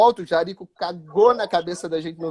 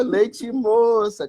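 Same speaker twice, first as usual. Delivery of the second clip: slow, higher-pitched teasing call; drawn-out heckle; 4 semitones higher, much slower than usual. Retold or imitated. Drunk fan starts on the goalkeeper again, slow teasing. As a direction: imitate the taunt, stretching both words.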